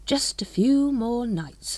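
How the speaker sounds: slow scheming murmur; scheming thought. Acted. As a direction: savour the plan slowly. Grand Master's private thought.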